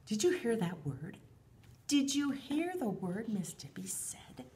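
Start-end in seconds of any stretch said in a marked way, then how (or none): 1.9-4.4 hushed question to the class; whisper. Acted. Teacher whispering to the children about the new word. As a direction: whisper it to draw them in.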